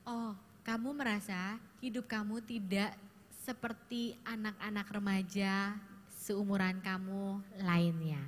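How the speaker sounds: slow, soft, gentle mirroring; calmly name the teen's feeling back; empathic reflection. Shown as a demonstration. Counselor mirrors a teen's feeling, slow and gentle. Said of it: "Saya bilang gini".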